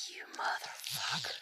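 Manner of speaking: voiceless whisper under the breath; whispered curse. Retold or imitated. Cursing the man who called her crazy, whispered.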